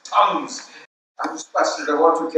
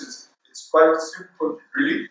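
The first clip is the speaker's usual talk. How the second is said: ordinary talk, nothing marked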